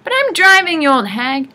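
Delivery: high, loud, indignant protest; shrieked old-woman bickering; squabbling. A narrator's reading. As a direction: hurl it back at your sister.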